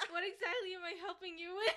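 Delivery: laughing while talking. Real, not acted. Laughing at friend's text.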